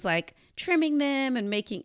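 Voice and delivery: ordinary talk, nothing marked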